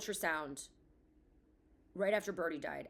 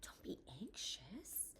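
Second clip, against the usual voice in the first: mock soothing whisper; 5 semitones higher, 12 dB quieter than usual. Mother imitating a nurse calming her, in a soft whisper. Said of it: "she comes in and she was like".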